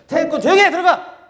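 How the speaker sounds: furious shouted orders; grumpy master snapping loudly; angry yell. Acted. Angry instructor yelling at child.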